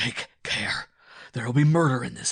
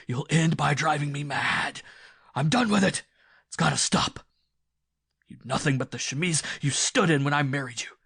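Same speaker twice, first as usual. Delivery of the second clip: loud, high raging shouts; husband raging and threatening murder; furious outburst; 4 semitones higher, 11 dB louder than usual. A narrator's reading. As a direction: let the wrath explode. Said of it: "burst forth with renewed violence".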